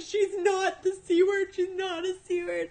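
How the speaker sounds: high, loud, half laughing, half crying; sob-laugh through the denial; mock teary protest, high and loud; retold crying protest. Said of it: "my mom cried, I cried".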